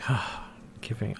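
very soft, sighing defeat; imitated despondency. Host voices the despondent couple giving up, mock dejected. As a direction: sigh it out in mock despair. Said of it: "despondent as well".

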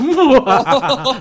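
loud high laugh. Real, not acted. Laughs after hammy line.

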